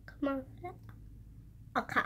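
fingers in mouth; pushing retainer into place. Real, not acted. Seen 3: Fingers push the retainer into her mouth until about 2.1s.

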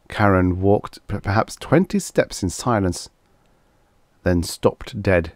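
usual speaking voice

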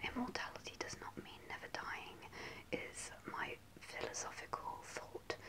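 ordinary talk, nothing marked

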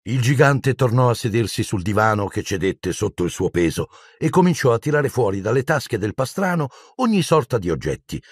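usual speaking voice

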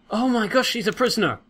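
shocked realisation, pitch up; surprised. Real, not acted. Discovers a prisoner.

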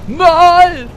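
high, loud anguished Nooo; Luke's cry. Retold or imitated. Answering his own Vader quote, a high loud mock cry. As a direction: howl the denial like the betrayed son. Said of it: "Ich bin dein Vater".

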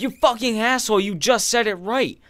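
high, loud furious rant; angry outburst. Acted. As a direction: yell it in exasperation at the offender. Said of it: "Nothing makes me angrier".

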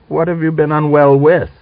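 officer doubting a claim of illness; sceptical question. Acted. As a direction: ask it doubtingly.

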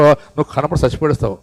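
usual speaking voice

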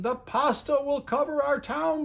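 high, fearful cry. A narrator's reading. The townspeople fear the flood, high fearful cry. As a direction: cry out the doom. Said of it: "they cried".

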